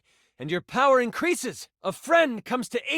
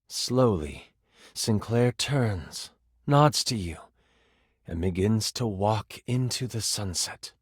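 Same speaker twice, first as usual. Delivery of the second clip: solemn narration; 10 semitones lower than usual. Acted. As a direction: close the scene gravely, deep in the register. Solemn slow narrated ending.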